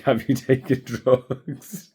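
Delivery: loud, high, laughing mock disbelief; laughing retold question. Amused retelling of dad's question.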